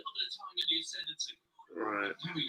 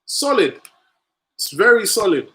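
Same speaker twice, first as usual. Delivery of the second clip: emphatic; 6 semitones higher, 7 dB louder than usual. Real, not acted. Praising defender.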